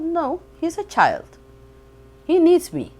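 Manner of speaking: mother protesting to officer, high and loud; retold protest. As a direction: plead for the child, voice rising high. Mother refusing officer's order.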